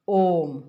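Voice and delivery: ओम with the O drawn out; prolonged vowel. Shown as a demonstration. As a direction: hold the O of ओम before closing on m. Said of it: "ओ को तीन मात्रा तक उच्चारण".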